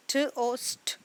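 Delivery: स्ट said as one unvoiced cluster; sounding out a blend. Shown as a demonstration. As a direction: keep स्ट together as a blend. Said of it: "इसको हम इकट्ठा बोलेंगे".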